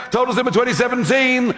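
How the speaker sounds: rapid-fire auctioneer rattle; auctioneer patter. Real, not acted. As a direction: race through it like an auctioneer. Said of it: "I sound like an auctioneer now".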